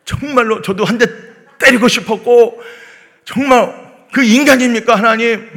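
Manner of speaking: loud, high, laughing indignant cry; angry outburst. Retold or imitated. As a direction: vent to God half-laughing. Venting anger in prayer. (웃음 섞인 분노의 외침)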